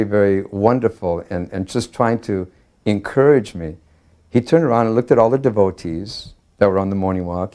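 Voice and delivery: normal talking voice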